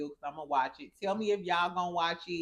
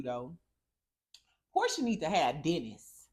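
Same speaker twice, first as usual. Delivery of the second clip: ordinary talk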